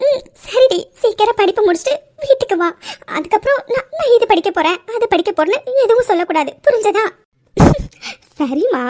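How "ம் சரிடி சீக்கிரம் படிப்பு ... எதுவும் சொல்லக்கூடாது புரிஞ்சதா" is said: high, weepy motherly nagging; emotional, raised-pitch demand; tearful insistence. Acted. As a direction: lay down terms while still crying. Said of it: "முதல்ல அலறதை நிறுத்துங்க".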